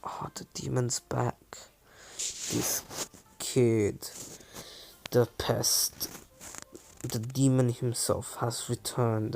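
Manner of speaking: whispered gossip. Acted. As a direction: whisper it behind his back. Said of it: "they start whispering".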